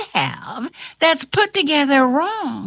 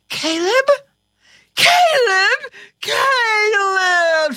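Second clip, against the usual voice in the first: shriek; 7 semitones higher, slower than usual. Acted. Kate recognizing Caleb, building to a high shriek. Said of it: "she shrieked".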